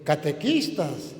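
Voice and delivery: usual speaking voice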